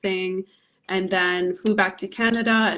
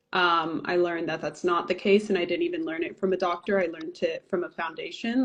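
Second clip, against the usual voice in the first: ordinary talk, nothing marked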